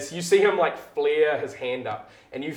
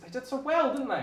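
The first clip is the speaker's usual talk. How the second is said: higher, pleased gossip; bright proud chatter; a hint of a sweet colleague; chirpy coworker; 11 semitones higher, 4 dB quieter than usual. Gorgia voiced by GM.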